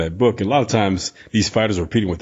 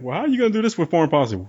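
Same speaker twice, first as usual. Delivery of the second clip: reporter's question; 7 semitones higher, faster than usual. Retold or imitated. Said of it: "he pulled the Donald Trump".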